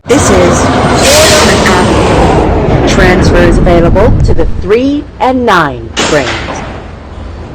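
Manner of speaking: spliced together words. Acted. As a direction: shout it like a patched-together PA. Spliced announcement, louder.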